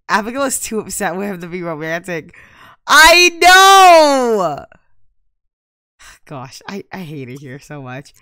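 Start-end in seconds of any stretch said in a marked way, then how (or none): 2.9-4.6 high, loud outburst; sharp cry of exasperation; loud exclamation. Real, not acted. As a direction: blurt it out, exasperated. Reacting to upset Sim.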